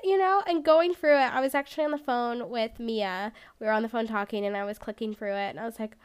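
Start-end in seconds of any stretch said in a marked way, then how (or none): none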